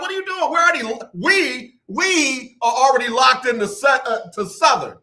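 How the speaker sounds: high, disbelieving 'What are you doing?'; high-pitched mock outrage at his daughter's college choice; incredulous protest. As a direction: play the baffled dad pleading with his daughter.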